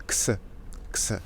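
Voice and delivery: "X" said as a k-s cluster; Spanish X sound. Shown as a demonstration. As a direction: demonstrate "X" as a crisp ks. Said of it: "siempre se pronuncia".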